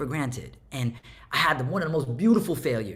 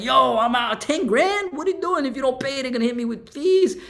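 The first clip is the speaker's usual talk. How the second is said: mock panic; 7 semitones higher than usual. Retold panic over tax bill.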